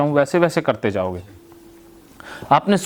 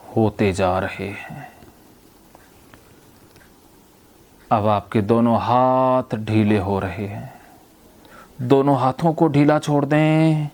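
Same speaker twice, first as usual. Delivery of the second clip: measured hypnotist's delivery, stretched key words and long pauses; hypnotic induction; slower than usual. Real, not acted. Therapist telling a patient their legs and hands loosen, hypnotic induction. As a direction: lull them slowly, letting each phrase sink away.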